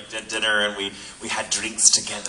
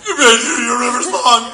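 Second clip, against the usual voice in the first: much higher, louder, slow fangirl squeal; gushing fan blurt; 13 semitones higher, 10 dB louder, much slower than usual. Retold or imitated. Fan imagining blurting to Alex Kingston, high squeal. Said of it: "been like".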